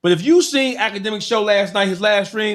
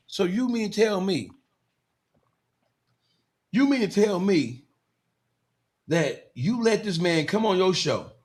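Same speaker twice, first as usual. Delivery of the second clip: usual speaking voice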